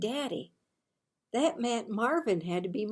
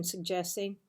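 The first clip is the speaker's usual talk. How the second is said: ordinary delivery, nothing marked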